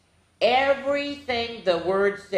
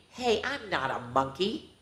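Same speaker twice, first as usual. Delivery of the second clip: plain reading voice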